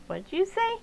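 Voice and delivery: high, puzzled question voice; confused relative. Acted. Relative puzzled by Noelle's Chinese, asking high. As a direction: ask what she said in a high voice.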